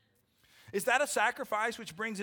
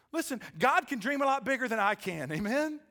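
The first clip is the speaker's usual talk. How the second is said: high, loud revival preaching; full-force preaching; 6 semitones higher, 4 dB louder than usual. Acted. Pastor urging the church to surrender, at full preaching force. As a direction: fire up the congregation.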